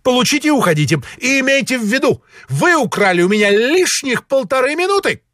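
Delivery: high, hurried peacock dismissal; self-important high-pitched reproach; haughty, impatient official. A narrator's reading. Suprugov dismisses Ostap.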